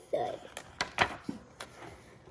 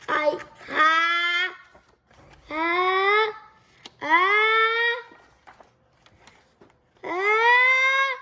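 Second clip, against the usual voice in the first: sneeze building; 2 semitones higher, much slower than usual. Acted. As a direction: climb toward a sneeze that doesn't come. Wind-up before a sneeze.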